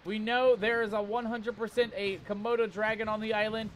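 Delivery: usual speaking voice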